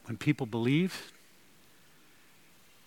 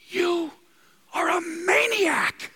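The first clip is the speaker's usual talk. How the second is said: much higher, loud, stretched-out yell; furious shout; 5 dB louder, much slower than usual. Retold or imitated. Festus shouting at Paul on trial, furious yell. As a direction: bellow it like an outraged governor. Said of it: "the word for shout means very loud voice".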